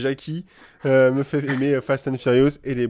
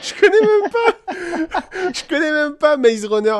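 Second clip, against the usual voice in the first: high, loud, laughing helplessly through the words; real laughter; 12 semitones higher, 6 dB louder, slower than usual. Real, not acted. Host admits not knowing the film, laughing loudly. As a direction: talk through a real fit of giggles. Fou rire en plein jeu.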